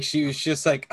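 ordinary talk, nothing marked

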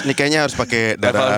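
low-pitched doctor voice. Retold or imitated. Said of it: "Dia ngelihat terus".

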